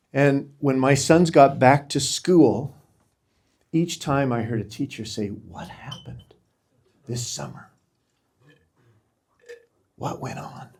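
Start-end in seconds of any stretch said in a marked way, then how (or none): 5.3-10.7 very soft, halting, almost voiceless; choked up. Real, not acted. Father retelling a teacher's question about his sons, choked up. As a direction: emotion swallowing a retold question. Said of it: "I'm gonna get emotional talking about this".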